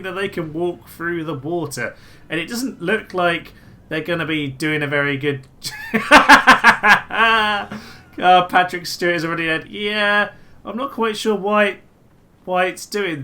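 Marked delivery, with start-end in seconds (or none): 5.5-8.1 loud laugh. Real, not acted. Laughs at army walking into water.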